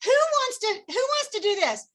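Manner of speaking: excited call to the group; fun and excited. Shown as a demonstration. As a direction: ham up the fun, pitched well up. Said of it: "Make it fun".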